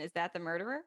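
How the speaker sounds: ordinary delivery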